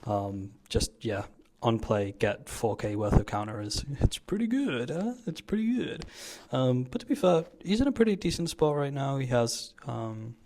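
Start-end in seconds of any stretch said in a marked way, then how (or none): 4.0-5.1 high squeaky teasing voice; mock high silly voice. To the viewers about his own play, mock high squeak. 5.3-6.1 high squeaky teasing voice; mock high silly voice. To the viewers about his own play, mock high squeak. Repeats the put-on voice.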